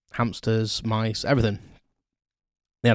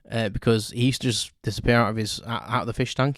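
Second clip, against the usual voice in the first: usual speaking voice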